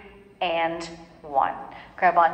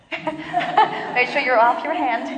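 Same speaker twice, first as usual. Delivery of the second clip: laughing cue; 5 semitones higher, slower than usual. Real, not acted. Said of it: "instructor laughs while cueing".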